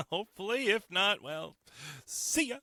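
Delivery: high, giggly "see ya"; laughing tease. Real, not acted. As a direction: chuckle a cheeky goodbye. Teasing listeners who left.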